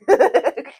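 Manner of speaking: loud high laugh bursting out; burst of laughter. Real, not acted. Laughing at the friend who left her baby.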